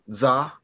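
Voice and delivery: Za said short and open; traditional Za. Shown as a demonstration. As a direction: say Za with a plain open a. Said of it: "you would say".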